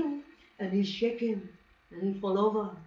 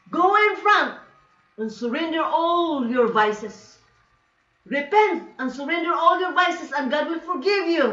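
loud, higher preacher's call; evangelist; 6 semitones higher, 6 dB louder than usual. Retold or imitated. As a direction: call sinners to repent, loud and emphatic. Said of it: "this evangelist, American, said".

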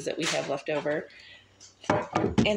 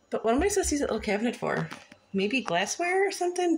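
puzzled; 7 semitones higher, faster than usual. Real, not acted. Baffled question about little cabinet.

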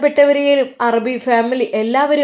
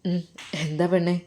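soft, low and bashful; a soft, lower shy answer; shy reply; 5 semitones lower, 8 dB quieter than usual. Acted. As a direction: murmur it shyly to his bride. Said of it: "നാണം കൊണ്ട്".

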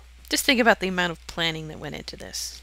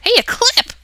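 high, loud squeal of delight; hammed-up gamer delight; mock excited; 12 dB louder than usual. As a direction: squeal over the find.

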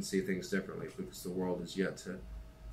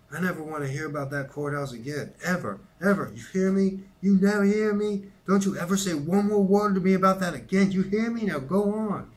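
much louder and higher, yelling in fury; furious shout; 11 semitones higher, 10 dB louder than usual. Acted. Big brother screaming at his sister to drop it, furious. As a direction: blow up at your little sister. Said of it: "Jim was suddenly furious".

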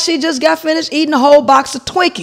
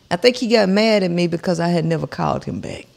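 normal talking voice

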